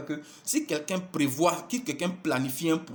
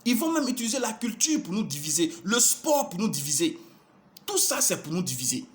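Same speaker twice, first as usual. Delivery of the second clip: rising preaching litany; 6 semitones higher than usual. Real, not acted. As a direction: build the refrain like a preacher at full force.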